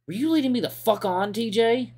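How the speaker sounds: angry shout. Acted. Chase demands TJ answer, shouting in hurt anger.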